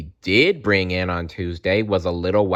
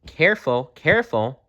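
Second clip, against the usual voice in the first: smiling bear; 6 semitones higher than usual. A narrator's reading. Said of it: "smiled the bear".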